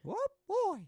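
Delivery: very high cartoon yelp; cartoon impression. Friend trades cartoon lines, high and loud. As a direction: pitch it squeakily high and loud.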